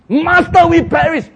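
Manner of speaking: high, loud desperate plea; panicked cry. Acted. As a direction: yell for help like a sinking fisherman. Disciples cry out in the storm.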